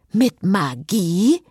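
slow, pointed snippy question. A narrator's reading. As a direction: drag it out cheekily. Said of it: "Erwiderte Cecile schnippisch". (schnippisch, langsam gedehnt)

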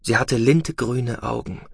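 ordinary delivery, nothing marked

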